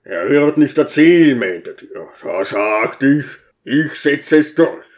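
smug, booming deacon; a proud deacon lording it over the guests; self-satisfied call. A narrator's reading. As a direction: crow over your little victory to the room. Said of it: "rief selbstzufrieden".

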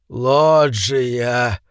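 put-on hoarse, bitter old man; bitter wheeze. A narrator's reading. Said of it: "горько просипел король горы".

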